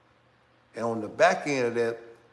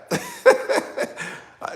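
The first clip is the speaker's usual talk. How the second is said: laughing; 7 dB louder than usual. Real, not acted. Laugh after his answer.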